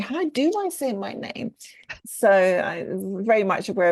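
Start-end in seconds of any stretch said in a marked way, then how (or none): 0.0-1.5 high-pitched baffled self-quote; mock puzzlement. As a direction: act out asking yourself, much higher. Acting out her own confusion.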